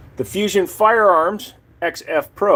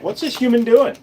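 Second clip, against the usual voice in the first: dog's voice; 6 semitones higher than usual. Acted. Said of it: "He's like".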